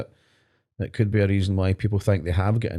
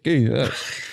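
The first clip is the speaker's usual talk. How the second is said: imitating a broad north of Aberdeen accent, breaking into laughter; quick mock northern Scottish accent; north-east Scots accent. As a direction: put on a thick far-north Scottish accent. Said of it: "if you go up north of Aberdeen".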